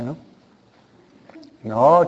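usual speaking voice

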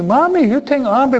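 put-on high, loud pleading child. Child begs mom for food late, high and loud. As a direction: whine like a hungry kid.

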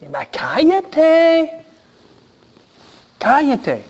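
high, drawn-out mock hushing yell; imitated annoyed bystander, pitched high; acted scolding crowd. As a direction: shout the beggar down. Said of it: "los hombres alrededor del ciego dicen".

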